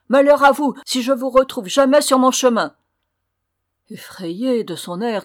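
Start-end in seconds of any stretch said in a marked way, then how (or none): 0.1-2.8 loud, high outburst of wrath; furious threat. A narrator's reading. Prince threatening the impostors as he banishes them, furious. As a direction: thunder a warning at them. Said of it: "s'écria-t-il".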